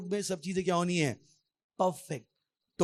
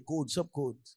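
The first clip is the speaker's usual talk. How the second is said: ordinary delivery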